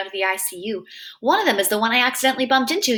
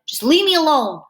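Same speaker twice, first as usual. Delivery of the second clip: louder, higher, pushing him away; upset rebuff; 5 semitones higher, 5 dB louder than usual. A narrator's reading. Boy rebuffs Grim on the way home, upset. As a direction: plead crossly to be left be.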